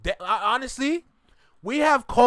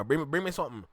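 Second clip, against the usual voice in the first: lower-pitched Jamaican patois; Caribbean accent; 4 semitones lower, 5 dB quieter, much faster than usual. Shown as a demonstration. Showing off own Caribbean accent, Jamaican patois. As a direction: give it in a low Caribbean patois. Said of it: "in my Caribbean accent".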